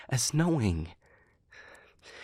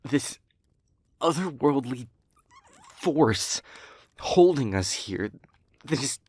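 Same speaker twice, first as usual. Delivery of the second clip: louder, emphatic, broken into hard-won phrases; anguished outrage; 3 semitones higher, 5 dB louder than usual. Acted. Speaker railing at the force that tormented them, anguished outrage. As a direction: build to a raised, incredulous protest.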